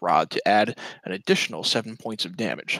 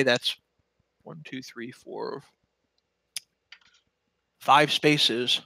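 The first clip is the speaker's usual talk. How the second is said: ordinary talk, nothing marked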